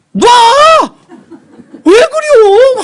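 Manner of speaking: high, loud rough yell; dialect shout. Retold or imitated. Son shouting at mother in Jeolla dialect. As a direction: bark it at mother. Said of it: "막 내가 그랬거든".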